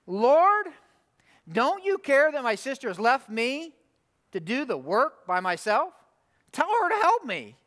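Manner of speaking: an exasperated sister tattling, pitch jumping on the demand; lathered-up complaint. Acted. Martha to Jesus about her sister, lathered up. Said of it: "Martha gets lathered up".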